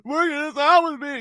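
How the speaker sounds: very high, wailing mock sob; hammed-up whiny cry; mock crying wife. Mocking the wife crying at him, high wail. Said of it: "she's like".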